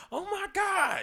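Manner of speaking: very high mock gasp of disbelief; imitated girlfriend shock. Girlfriend scolding him over last episode, mock shock. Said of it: "she was getting on me".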